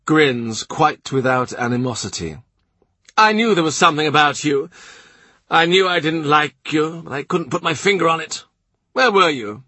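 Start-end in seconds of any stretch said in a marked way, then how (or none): 3.3-9.6 the blustering Duke of Norfolk, pitched higher; gruff duke. A narrator's reading. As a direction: play the proud, impatient duke. Duke voiced.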